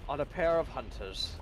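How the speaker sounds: nature documentary narrator voice; a hint of wildlife narration; David Attenborough voice. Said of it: "full-on David Attenborough voice".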